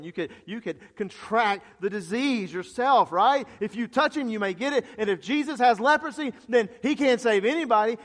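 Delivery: fervent pulpit warning; impassioned preaching. Real, not acted. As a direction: pile up the consequences with mounting force. Preaches with rising force.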